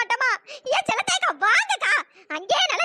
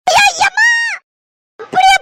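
scared scream; 8 semitones higher, 11 dB louder than usual. Acted. Frightened girl screams at photo.

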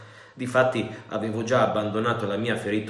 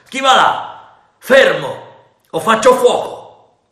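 loud, high commanding shout; stern challenge; 12 semitones higher, 15 dB louder, slower than usual. A narrator's reading. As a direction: warn them off at full voice. Captain challenges the intruders.

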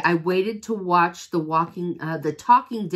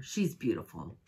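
tender; 10 semitones lower, 11 dB quieter than usual. Real, not acted. Soft admiring of product.

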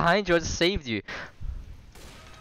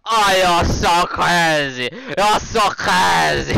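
high, loud, slow mock whine; mocking imitation; 4 semitones higher, 7 dB louder, much slower than usual. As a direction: mimic him in a silly squeaky voice. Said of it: "He's like".

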